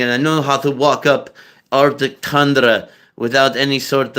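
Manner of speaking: semi-Russian Balkan accent. Shown as a demonstration. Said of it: "where they kind of sound Russian".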